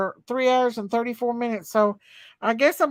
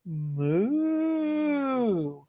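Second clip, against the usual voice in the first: long drawn-out moo, softer; cow moo; 6 dB quieter than usual. Pretend. Streamer as Daisy Cow for a birthday child, cow moo. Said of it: "Daisy Cow says".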